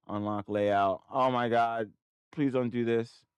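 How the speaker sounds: loud exasperated pleading; pissed off. Real, not acted. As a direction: plead loudly with a stubborn computer. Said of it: "I am so pissed right now".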